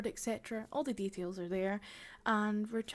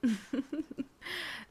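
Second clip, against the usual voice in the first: witch laugh; 5 semitones higher than usual. Acted.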